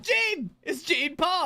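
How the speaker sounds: high-pitched mock exclamation; excited recognition. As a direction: imitate someone suddenly recognizing a celebrity. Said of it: "someone turns around and goes".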